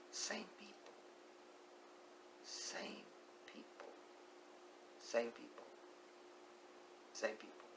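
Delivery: breathy whisper of the same words; hushed, pointed repetition; whispered refrain. Real, not acted. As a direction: let it drop to a near-silent whisper. Emphatic repeated refrain.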